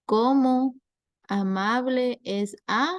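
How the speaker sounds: ¿Cómo punched high and loud, then a rising question; quiz question. Shown as a demonstration. Teacher reading a sample test question to students, stressing ¿Cómo. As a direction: stress ¿Cómo as you read the test item. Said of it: "Y luego le preguntan".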